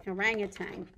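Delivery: ordinary talk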